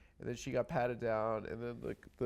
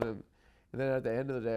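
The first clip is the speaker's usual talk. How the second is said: normal talking voice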